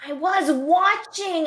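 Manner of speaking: put-on loud, high, slowed old-lady voice, straining to hear; hammed-up confused old woman asking too loud; confused elderly moviegoer. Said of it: "the other person would be like".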